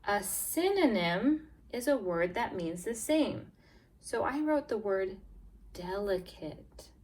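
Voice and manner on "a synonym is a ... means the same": normal talking voice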